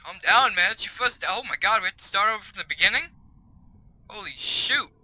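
high, loud disbelief; exasperated outburst. Real, not acted. Player learning he must restart, exasperated. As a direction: blurt it out, worked up.

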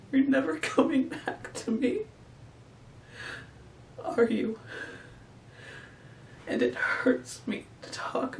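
high, fragile grieving monologue; high-pitched, softening sorrowful refrain; acted heartbreak. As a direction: lift it high and let it fade into long pauses.

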